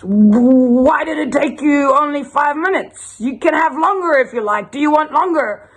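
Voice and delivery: high-pitched, insistent pirate. Acted. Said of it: "and the pirate is".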